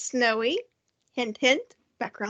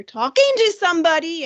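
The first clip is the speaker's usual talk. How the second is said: suddenly louder and higher; raised voice; 4 semitones higher, 5 dB louder than usual. Shown as a demonstration. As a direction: raise your voice to demonstrate emphasis. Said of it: "you raise your voice".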